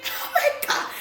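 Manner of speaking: high, loud laughing exclamation. Real, not acted. Vlogger cracking up in front of viewers, laughing. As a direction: burst out laughing, drawing the words out.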